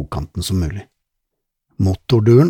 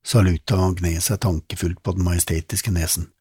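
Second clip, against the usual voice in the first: ordinary delivery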